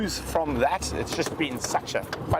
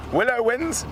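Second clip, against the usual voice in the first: excited; 11 semitones higher, 3 dB louder than usual. Real, not acted. Announcing the winner excitedly.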